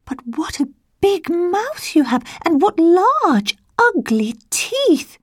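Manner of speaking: put-on high, slow child's voice; little girl voice. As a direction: stretch it out like a scared little girl. Child questions disguised wolf.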